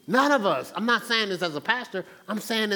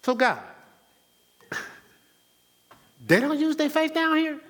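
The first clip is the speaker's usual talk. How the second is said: bewildered Jesus; 8 semitones higher than usual. Retold or imitated. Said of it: "having some deep conversation".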